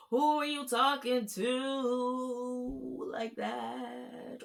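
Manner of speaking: incredulous, long-held challenge; indignant disbelief. Real, not acted. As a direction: stretch every word in sing-song outrage. Mock outrage at the man.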